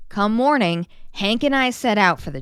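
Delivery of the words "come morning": ordinary delivery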